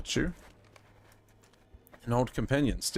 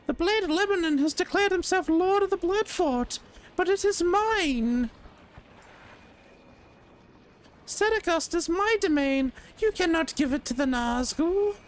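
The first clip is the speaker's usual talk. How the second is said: very high squeaky indignant voice; shrill high-pitched protest; Lareth high voice; 3 dB louder than usual. Acted. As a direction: squeal like a wronged little lord. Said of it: "a different voice for Lareth".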